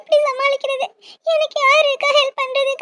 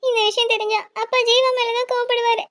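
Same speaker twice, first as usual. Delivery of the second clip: crying. Acted. Sister crying to brother about father's anger. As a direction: speak through sobs.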